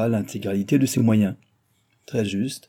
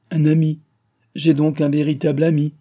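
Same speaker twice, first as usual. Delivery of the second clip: louder, higher outburst of joy; overjoyed; 5 semitones higher, 6 dB louder than usual. A narrator's reading. Sans Patience rejoices that the engineer will come, overjoyed. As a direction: exult at having a real friend.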